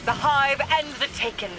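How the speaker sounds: high, urgent cry of recognition; excited discovery. Acted. Queen locating the enemy ritual, calling out high and urgent. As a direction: pitch it up as if you finally spotted her.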